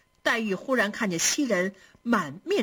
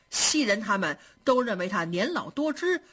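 usual speaking voice

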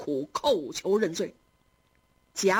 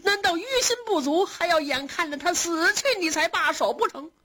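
higher, fast, tear-choked rebuke of her son; tearful reproach; 9 semitones higher than usual. A narrator's reading. Grandmother orders her son out, tearful and indignant. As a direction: drive him out, grieving and indignant. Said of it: "含着眼泪说".

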